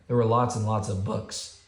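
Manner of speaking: usual speaking voice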